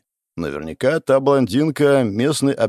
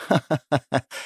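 fake laugh; 4 semitones higher than usual. A narrator's reading. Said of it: "притворно засмеялась".

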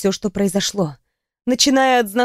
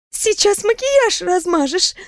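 higher, slow teasing voice; teasing young woman; 9 semitones higher, slower than usual. A narrator's reading. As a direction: break the tension lightly. Riona lightens mood.

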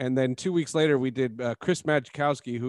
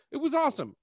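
high bright enthusiasm; excited; 12 semitones higher, faster than usual. Real, not acted. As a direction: pitch way up with delight. Enthusing about the show.

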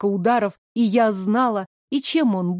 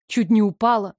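usual speaking voice

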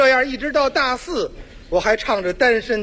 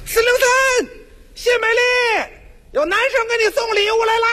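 扯着嗓子高声喊; 在楼道里拉长声音高喊; 楼道里喊; 11 semitones higher than usual. Retold or imitated. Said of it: "楼长得在楼道里喊". (模仿楼长在楼道里大声喊人)

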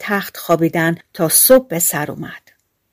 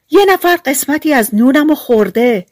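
higher, louder dwarf shout; 6 semitones higher, 4 dB louder than usual. A narrator's reading. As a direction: call it out like an upset little dwarf. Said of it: "سومی داد زد". (فریاد کوتوله)

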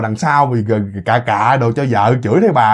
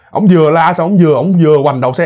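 usual speaking voice

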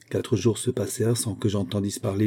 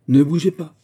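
raised, commanding order at gunpoint; sharp command; 6 semitones higher, 6 dB louder than usual. Acted. Gentleman thief to the duke at gunpoint, loud command. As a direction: bark it as a threat.

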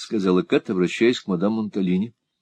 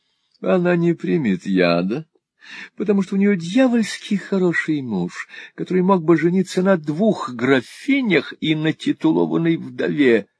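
higher, cajoling foppish husband; changed voice, nearer; 8 semitones higher than usual. A narrator's reading. Husband moved closer to wife, high cajoling. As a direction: wheedle boastfully at a raised pitch. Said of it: "судя по изменившемуся голосу".

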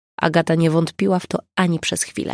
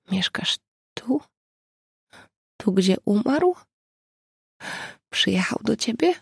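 quiet question; 5 semitones higher than usual. A narrator's reading. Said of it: "zapytała cicho".

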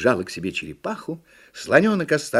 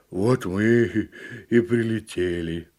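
lightly voiced little elephant; understated elephant letdown; deflated elephant; slower than usual. A narrator's reading. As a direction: state it ponderously, with a pause midway. Elephant after crash.

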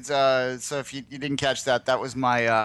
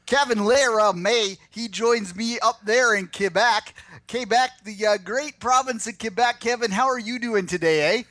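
hammed-up, higher-pitched rural Canadian twang; rural Canadian accent; 8 semitones higher than usual. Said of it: "rural Canadian accent".

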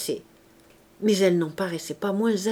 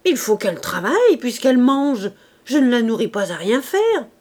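loud, sour reply; an innkeeper's wife answering sourly and loud; sour retort; 3 semitones higher, 6 dB louder than usual. A narrator's reading. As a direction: snap back, bitter and loud. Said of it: "Elle répartit aigrement".

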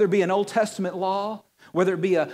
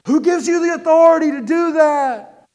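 indignant priests; 9 semitones higher, 11 dB louder than usual. Acted. Temple priests challenge Jesus.

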